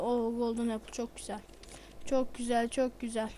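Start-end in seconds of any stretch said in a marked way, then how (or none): none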